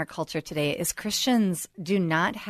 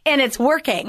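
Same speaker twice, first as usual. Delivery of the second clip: much higher and louder, with an exclamation point; emphatic exclamation; 7 semitones higher, 7 dB louder than usual. Shown as a demonstration. Host cheering the guest's theater program, emphatic exclamation. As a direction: belt it out triumphantly. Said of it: "Exclamation point".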